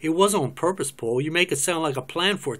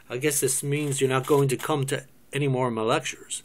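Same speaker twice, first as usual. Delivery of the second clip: ordinary talk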